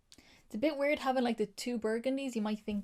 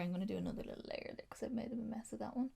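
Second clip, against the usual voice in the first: quick, hushed aside to herself; whispered aside; 8 dB quieter, faster than usual. Real, not acted. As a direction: whisper it while concentrating. Muttered aside while fixing swatch.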